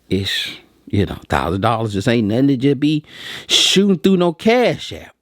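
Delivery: normal talking voice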